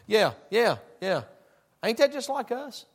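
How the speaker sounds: ordinary delivery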